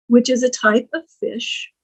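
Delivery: ordinary talk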